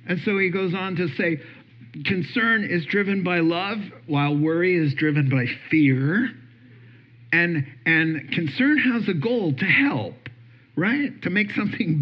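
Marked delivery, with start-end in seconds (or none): none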